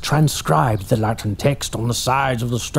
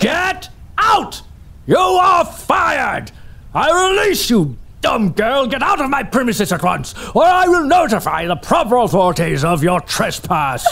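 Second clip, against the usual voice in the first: furious yelling; 9 semitones higher than usual. Acted. Employer firing his assistant, furious yelling. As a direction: throw her out at the top of your voice. Donald fires Casey, ranting.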